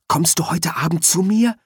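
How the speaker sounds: ordinary delivery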